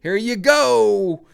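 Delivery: "Here you go" stretched slow, high and loud; drawn-out mocking "Here you go". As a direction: drag out "Here you go", hamming it up. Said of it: "Every single officer".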